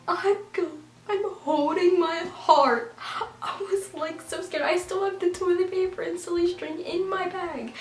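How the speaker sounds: high-pitched, worked-up fright; re-live the scare in a high squeal; panicked retelling. Retold or imitated. Caught holding the toilet paper, high panicked retelling. Re-lives being scared with evidence in bag.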